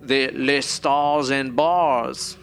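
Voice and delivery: very slow, hammed-up French accent; exaggerated, stretched-out French; mock French accent. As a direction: draw it out in a silly French accent. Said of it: "who in fucking France is like".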